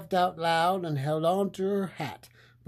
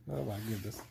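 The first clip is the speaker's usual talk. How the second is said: ordinary delivery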